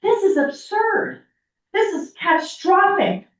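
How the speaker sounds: real exasperation at the council; outraged. Real, not acted. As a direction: let the outrage climb in pitch.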